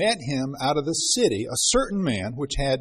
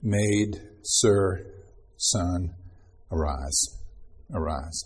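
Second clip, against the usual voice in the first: low, soft call sinking lower to a hush; tender call growing lower and softer; tender fading call; 6 semitones lower, 4 dB quieter, slower than usual. Acted. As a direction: wake them gently from death's sleep. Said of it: "And he's gonna say".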